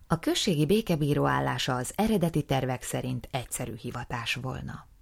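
ordinary talk

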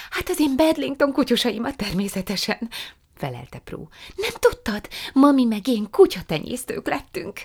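Prue's eager voice. A narrator's reading. Prue apologising to her aunt, high and eager. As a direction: apologise sweetly and brightly.

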